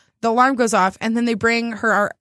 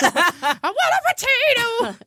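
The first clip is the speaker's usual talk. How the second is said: high, slow laughing whine; whiny laughing; 12 semitones higher, much slower than usual. Pretend.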